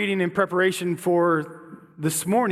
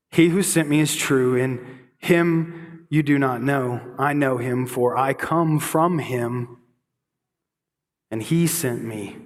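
solemn scripture reading; 5 semitones lower than usual. Acted. Rereads John passage slowly.

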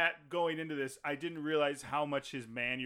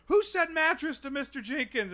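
much higher, louder comic yell; mock accusing shout; 13 semitones higher, 6 dB louder than usual. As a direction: shout out the accusation like a sketch character. Acted sketch characters shouting accusation.

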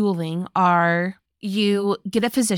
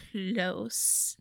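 hushed, stretched-out innuendo; insinuating; 13 dB quieter than usual. Real, not acted. Narrator hints at rumors about the two soldiers, insinuating. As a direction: drop softer and hint at more. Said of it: "you're doing finger, finger, uh, gestures".

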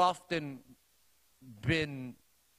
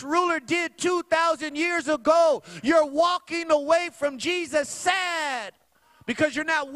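much higher and louder, pleading hard; impassioned rebuke; 8 semitones higher, 6 dB louder than usual. Retold or imitated. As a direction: plead with her at full force. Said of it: "I was telling her".

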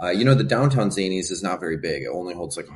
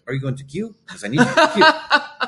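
hammed-up club owner accent, climbing much higher and louder; imitated accent breaking toward laughter, kill as "kyu"; Jamie Gong's accent; 7 dB louder than usual. Club owner in documentary mashup, imitated accent. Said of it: "exactly what Jamie Gong sounds like".

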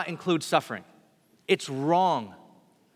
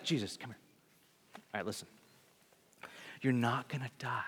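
pulled-aside hush, much softer, like a secret; 4 semitones lower, 10 dB quieter, faster than usual. Retold or imitated. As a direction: lean in and hush the words. Peter pulls Jesus aside.

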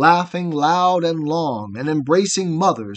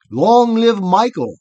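loud, high, joyful cheering shout; shouted cheer in triumph; crowd cry; 6 semitones higher, 7 dB louder than usual. A narrator's reading. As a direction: hail him like a cheering crowd. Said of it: "they cried".

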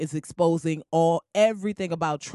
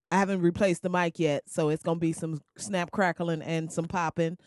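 ordinary talk

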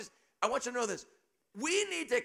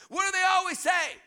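shouting; 9 semitones higher, 6 dB louder than usual. Real, not acted. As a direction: shout it, much higher and louder. Preacher building up to punchline.

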